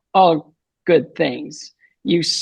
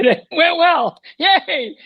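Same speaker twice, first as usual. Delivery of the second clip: much higher, gleeful little cheer; relieved joy; 11 semitones higher, much slower than usual. Retold or imitated. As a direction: celebrate the good news with a laugh. Relief after brain surgery.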